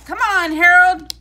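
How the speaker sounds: loud, high shout of encouragement; cheering shout. A narrator's reading. Shirley on the bench cheers Harold at the plate, loud high yell. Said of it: "shouted Shirley on the bench".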